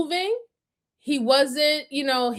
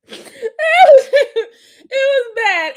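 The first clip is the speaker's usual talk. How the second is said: much higher and louder, breaking into laughter; laughing hard; 11 semitones higher, 9 dB louder, slower than usual. Real, not acted. Streamer to chat, talking through real laughter. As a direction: try to speak through a burst of laughter.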